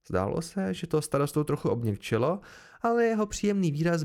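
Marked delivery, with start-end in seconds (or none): none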